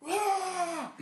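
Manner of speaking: sudden very high mock scream; imitated waking scream. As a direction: bolt upright screaming from a dream. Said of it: "shoot upright screaming".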